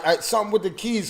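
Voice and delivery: ordinary talk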